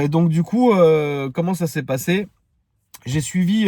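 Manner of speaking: ordinary talk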